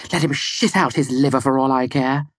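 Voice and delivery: high, scornful woman; female voice. A narrator's reading. Woman dismisses a man's anger, high and scornful. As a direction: spit it out high and uncaring. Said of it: "a familiar female voice came out".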